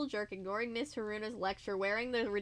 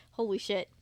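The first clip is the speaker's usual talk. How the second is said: ordinary talk, nothing marked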